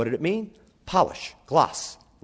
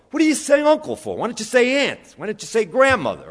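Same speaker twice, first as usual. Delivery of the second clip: mock indignation; 8 semitones higher, 5 dB louder than usual. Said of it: "I always go".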